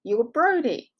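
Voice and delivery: mild yell to catch someone's attention; calling out. Retold or imitated. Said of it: "yell out".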